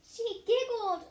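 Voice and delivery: high, loud, slow wail; bursting into tears. A narrator's reading. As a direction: break down sobbing as you say it. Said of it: "burst into tears again".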